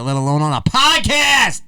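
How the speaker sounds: loud, high exasperated outburst; indignant yell. Real, not acted. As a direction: build to a real yell on the last word. Outraged rant builds to a yell.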